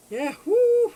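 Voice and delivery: very high, loud whoop; excited whoo. Real, not acted. Cheering for her fish, loud high whoop.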